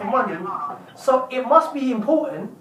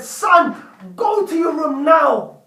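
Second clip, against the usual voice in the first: angry father; 4 semitones higher, slower than usual. Acted. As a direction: snap the kid off to his room. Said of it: "turned red and got angry and said".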